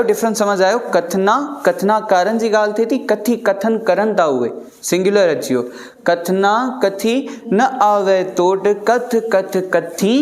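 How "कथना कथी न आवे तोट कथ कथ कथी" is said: a teacher chanting the line for the class; slow recitation. Shown as a demonstration. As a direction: recite कथना कथी न आवे तोट slowly. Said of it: "teacher recites line slowly".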